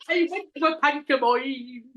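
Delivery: imitating a bad Irish accent; mock Irish accent. Co-host mimicking his attempt, mock Irish accent. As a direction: laughingly parody the Irish accent.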